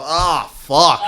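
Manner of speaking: retold dismayed cry. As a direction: cry out as it dawns on you. Retold moment of dismayed realization.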